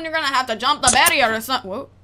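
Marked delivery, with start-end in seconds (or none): none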